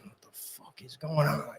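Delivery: whispered, half-asleep confusion; sleepy hushed question; groggy whisper. Retold or imitated. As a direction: breathe it out, just woken. Woken by screaming, groggy reaction.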